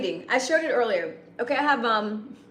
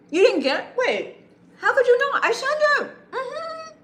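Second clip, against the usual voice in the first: indignant disbelief; 5 dB louder than usual. Real, not acted. Viewer claims message not received.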